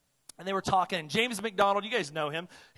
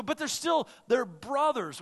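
high, pleading objection, louder; raised, higher protest; imagined objector; 7 semitones higher, 4 dB louder than usual. Pretend. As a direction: voice a listener defending them. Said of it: "And you go".

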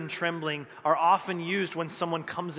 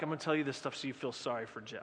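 fast conspiratorial whisper; whispered aside; 4 semitones lower, 8 dB quieter, faster than usual. Retold or imitated. Pastor confiding his pity ploy to the congregation, fast whisper. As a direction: whisper it like a sneaky scheme to win pity.

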